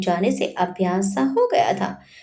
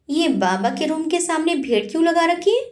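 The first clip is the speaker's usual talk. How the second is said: stern, higher-pitched demand; 7 semitones higher than usual. A narrator's reading. Asking the manager sternly about the crowd outside the room, stern voice. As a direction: put a hard edge on the question. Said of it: "कड़ी आवाज में पूछा".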